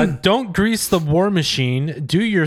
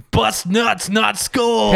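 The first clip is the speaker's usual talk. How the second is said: slogan read a little higher, in a put-on voice; mock slogan read; 5 semitones higher, slower than usual. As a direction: announce it like a bumper sticker. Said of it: "It's like".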